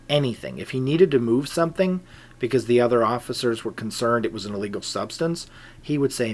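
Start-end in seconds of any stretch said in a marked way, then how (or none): none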